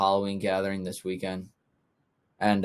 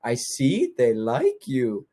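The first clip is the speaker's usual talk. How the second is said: slow cooing; 2 semitones higher, much slower than usual. Retold or imitated.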